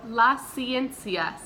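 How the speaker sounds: Las ciencias drawn out slowly and clearly; slow model word. Shown as a demonstration. Teacher modeling a word slowly for the class to repeat. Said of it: "Repite, por favor".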